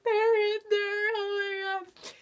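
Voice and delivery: high, drawn-out starstruck gasp; mock fangirl excitement. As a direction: imitate breathless fans at the door. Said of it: "we're like".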